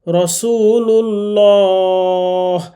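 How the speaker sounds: রসুলুল্লাহ stretched with a thick, dark lam; heavy lam, very slow. Shown as a demonstration. Teacher demonstrates the thick lam after pesh. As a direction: recite রসুলুল্লাহ slowly, lam full and heavy. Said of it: "লা পড়া যাবে না".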